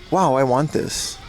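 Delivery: usual speaking voice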